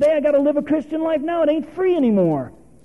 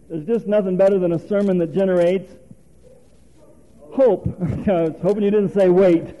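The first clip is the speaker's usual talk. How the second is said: ordinary talk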